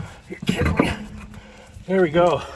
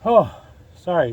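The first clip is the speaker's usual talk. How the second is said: ordinary talk, nothing marked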